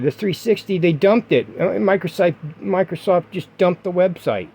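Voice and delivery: normal talking voice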